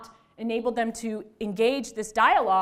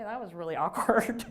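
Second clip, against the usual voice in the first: laughing; 3 semitones lower than usual. Retold or imitated. Laughs while retelling awkward reaction.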